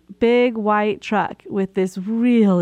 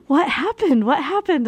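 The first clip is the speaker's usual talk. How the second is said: concerned coworkers; 7 semitones higher than usual. Retold or imitated. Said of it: "they're all so concerned".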